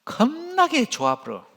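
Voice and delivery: high, punchy Jeolla dialect delivery; 겁나게 좋아 불어 in high, lively Jeolla dialect. Shown as a demonstration. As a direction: say it like a proud Jeolla local. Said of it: "전라도 말로 하면 어떻게 할까요?".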